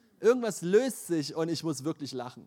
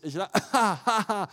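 higher, louder words dissolving into laughter; laughing it out; 6 semitones higher, 7 dB louder than usual. Retold or imitated. Sick preacher begins laughing in bed, words melting into laughter. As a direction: let the laugh take the words.

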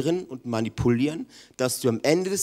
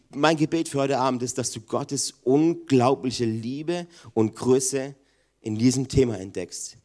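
ordinary talk, nothing marked

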